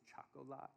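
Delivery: "Chocolat" very softly, as choc-o-lah; hushed toddler mispronunciation of "Chocolat"; little girl's pronunciation. Retold or imitated. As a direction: imitate a small child's "Chocolat" with an open last syllable. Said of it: "She would say".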